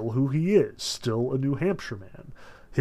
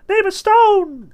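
very high, thin, piping squeak; a tiny trapped soul squeaking for help; small piping voice; 10 dB louder, slower than usual. A narrator's reading. Tiny moth-soul cries to the farmer in a high piping squeak. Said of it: "in a small sort of piping voice".

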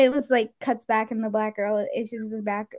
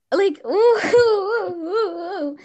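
1 disgusted ooh; 8 semitones higher, 5 dB louder, much slower than usual. Real, not acted. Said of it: "that's kinda nasty".

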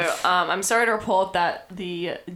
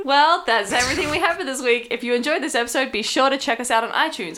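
podcast outro read; 5 semitones higher, 4 dB louder than usual. Acted. Reads the outro.

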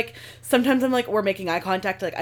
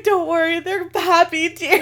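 teary reassurance; 9 semitones higher than usual. Retold or imitated. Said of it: "And I was like".

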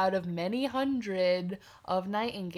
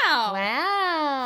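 mock wow; 6 semitones higher, 6 dB louder than usual. Echoing amazed wow.

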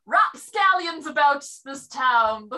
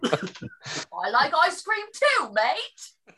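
slow, hammed-up British "mate"; mock British accent; much slower than usual. Friend mocks the innkeeper's accent, hammed-up and slow. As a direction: poke fun at the innkeeper's accent slowly. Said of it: "doing his accent".

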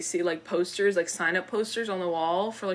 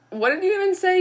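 high, loud mock disbelief; retold incredulity; 10 semitones higher, 7 dB louder, faster than usual. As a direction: quote it back, pitched up and baffled. Retells co-host's startled question.

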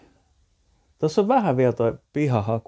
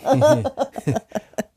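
high laugh; 4 dB louder than usual. Real, not acted. Laughs at the half-sofa.

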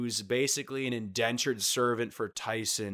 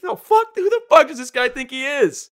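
high, loud mock outrage; indignant sober disciple losing it; furious rant; 11 dB louder than usual. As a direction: yell like an offended fisherman. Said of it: "And he's like".

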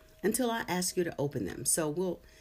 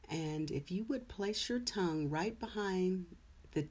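guided meditation; 5 dB quieter than usual. Acted. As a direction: ease them into calm. Leads a meditation.

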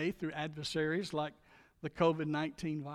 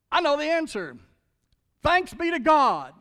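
raised, loud cry of triumph; exultant Paul; 7 semitones higher, 9 dB louder than usual. Retold or imitated. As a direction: proclaim it like a man set free.

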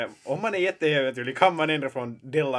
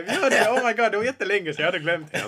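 excited remembering; 7 semitones higher, 5 dB louder than usual. Real, not acted. Suddenly remembers the confirmands.

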